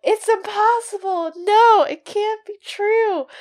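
high-pitched theatrical denial, slowing at the end; melodramatic disbelief. Acted. Witch refusing to believe the boy's claim, melodramatic disbelief. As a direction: wail it in mock horror. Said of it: "she whispers that".

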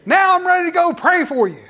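high, loud hammed-up boast; mock faith healer. Pastor mocking charged-up prayer with a high, loud boast. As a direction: puff up like a TV healer.

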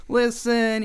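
high, drawn-out opening appeal; smooth-talking plea. Acted. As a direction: stretch it high, trying to talk your way out. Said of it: "talk his way out".

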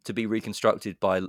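ordinary talk

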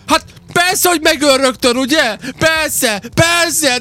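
high, drawn-out outraged mock shout; imitated gamer yelling. Host imitating a friend losing at a game, high-pitched yelling. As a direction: howl like a gamer killed out of nowhere. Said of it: "és üvöltözöl".

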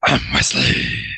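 low, slow lightly voiced Batman. Shown as a demonstration. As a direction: drop it low and slow like a hero. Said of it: "that was supposed to be, "I'm Batman".

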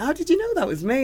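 high, amazed question; astonished retold question; surprised. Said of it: "And I went".

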